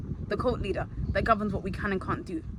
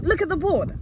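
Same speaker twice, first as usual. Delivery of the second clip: mock teacher bark; 8 semitones higher than usual. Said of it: "She's like".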